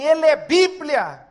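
high, loud yell to flee; alarmed robber's shout. Retold or imitated. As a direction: shout to your partner to get away. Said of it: "o rapaz gritou assim".

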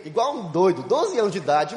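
usual speaking voice

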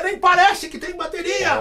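lady pastor taking the microphone, high mock voice; imitating old lady pastor. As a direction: ham up a stern church lady. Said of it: "Mas é uma senhora, né, quadrangular".

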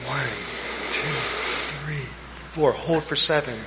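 slow, soft counting of breaths. Shown as a demonstration. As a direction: count the breaths slowly and quietly. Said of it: "Try it again".

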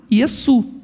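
"Yesu" with s, stressed on SU; name it in Aramaic, "Yesu", ye-SU; Aramaic pronunciation. Shown as a demonstration. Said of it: "o nome que se falava de Jesus em". (pronúncia aramaica, "Yesu" com su tônico)

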